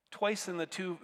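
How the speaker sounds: ordinary delivery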